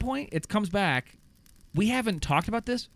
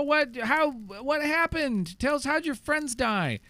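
higher, pushy questions from the press; mock eager reporters; 13 semitones higher, slower than usual. Reporters questioning returning survivor.

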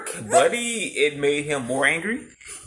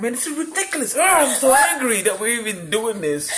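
high, put-on exasperated plea; mock exasperation; 9 semitones higher than usual. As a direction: ham up the outrage in a high voice. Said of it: "I was like".

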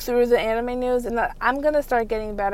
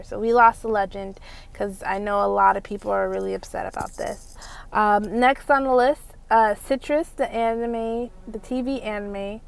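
usual speaking voice

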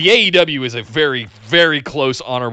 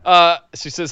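usual speaking voice

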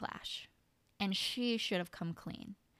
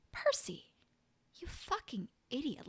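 whispered scolding; 7 semitones higher than usual. Real, not acted. Scolding the character.

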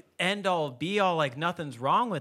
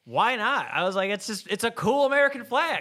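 higher, louder mock defensiveness; indignant retort; 7 semitones higher, 4 dB louder than usual. Host defending his garage flag to a guest, indignant retort. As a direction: protest it like the answer is obvious.

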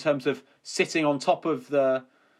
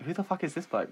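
under-the-breath aside; 3 semitones higher, 8 dB quieter than usual. Retold or imitated. Asking a friend about an approaching stranger, under the breath. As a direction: say it softly so he won't overhear. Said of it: "I didn't say it loud enough".

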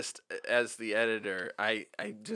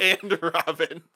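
mocking; 7 semitones higher, 8 dB louder, slower than usual. Mocks title.

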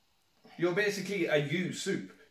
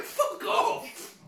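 very high squeal through laughter; helpless laughing exclamation; laughing retort. Retold or imitated. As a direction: squeak out a mock outburst while cracking up. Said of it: "It's like".

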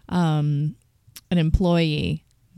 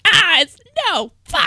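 sudden high frustrated cry; dismayed outburst; 13 semitones higher, 6 dB louder than usual. Retold or imitated. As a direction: realize it's the wrong song. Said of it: "And I'd be like".

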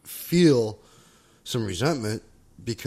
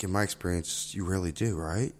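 ordinary talk

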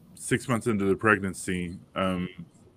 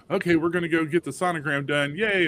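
high, cheery mock celebration; upbeat and high, ending on a little cheer; retold excitement; 8 semitones higher than usual. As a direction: retell it as eager good news, pitched well up. Retold happy announcement to family.

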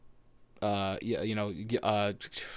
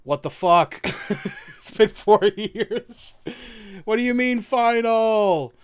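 loud, high stunned outburst with laughter; shocked disbelief; 8 semitones higher, 4 dB louder, slower than usual. Retold or imitated. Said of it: "Zach has a moment of".

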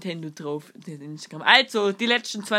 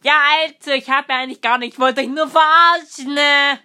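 loud teasing reveal; 6 semitones higher, 9 dB louder than usual. Acted.

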